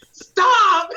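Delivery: sharp high loud shout, half laughing. Retold or imitated. Screaming at the computer mid-edit, loud and laughing. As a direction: bark it at the screen, fed up. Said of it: "I'm screaming at the computer".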